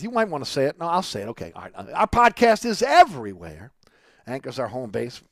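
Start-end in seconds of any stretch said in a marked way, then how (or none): 1.9-3.7 loud, high announcer-style holler; belted catchphrase. Pretend. Radio host plugging his podcast to listeners, belted out loud and high. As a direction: belt it out like a big slogan.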